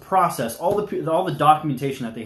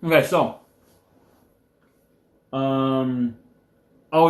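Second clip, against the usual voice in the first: ordinary talk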